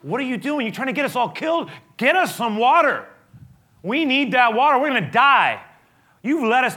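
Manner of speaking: high, loud, furious demands; angry shouting crowd. Retold or imitated. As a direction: yell at Moses like a hostile mob. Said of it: "Why are you so angry?".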